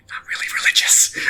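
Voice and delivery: hushed whisper, quick and conspiratorial; whispered aside. Acted. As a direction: lean in and whisper it behind her back. Gil mutters aside at prayer.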